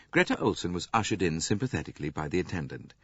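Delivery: ordinary delivery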